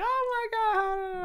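very high, slow, squealed oh my God; mock customer gasp. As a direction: ham up a gushing customer's squeal. Customer voiced laughing at window.

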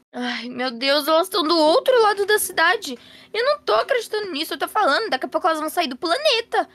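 high, rushed exasperated mother; alarmed disbelief. Acted. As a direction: rattle it off in high-pitched disbelief. Mãe descobre meninas muito longe. (incrédula e alarmada, voz aguda)

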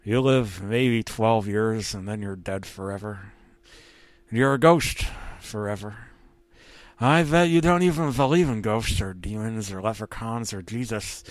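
put-on voice of a brooding ghost cat; ghost cat's voice. Tom's ghost talks of death forever, ghost voice. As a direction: speak as a dead cartoon cat lecturing the living.

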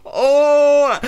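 emotional cry. Real, not acted. Overwhelmed by favourite band's return.

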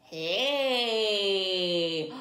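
ordinary delivery, nothing marked

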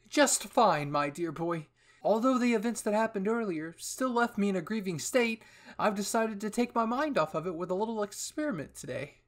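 high, loud theatrical chirp; cheerful eccentric commander. Acted. Hanji answering Eren about her mood, high theatrical cheer. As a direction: announce it grandly, full of sudden cheer.

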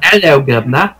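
English accent. Shown as a demonstration. Showing a friend an attempt, English accent. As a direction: put on a cartoon English accent. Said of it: "I do an English accent".

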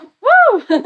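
high, loud celebratory "woo"; excited whoop. Retold or imitated. New realtor whooping at the title, excited. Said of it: "was just like".

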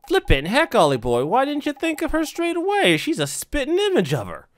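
high, loud, incredulous squawk; exasperated excitable sidekick. Acted. Drippy scolding Ollie for missing the obvious, exasperated. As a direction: burst out at the boy, pitch leaping up.

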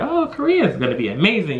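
acted naive excitement; excited anticipation. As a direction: gush like a hopeful newcomer. Naive expectation before going there.

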